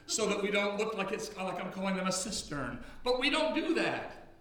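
full-force sermon delivery; preaching. Acted. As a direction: preach it to the congregation. Preacher back in full flow.

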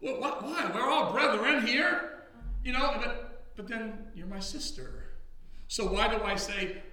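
full-force sermon delivery; preaching. Acted. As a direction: preach it to the congregation. Preacher back in full flow.

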